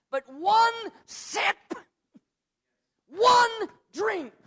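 slow, high, ringing emphasis on "one sip, one drink"; stress on one. Real, not acted. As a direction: stretch out "one" high and loud.

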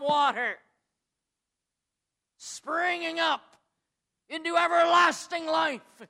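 slow, rising, high and loud "springing up into everlasting life"; preaching climax. Real, not acted. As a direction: build to a ringing shout. Scripture reading climaxes.

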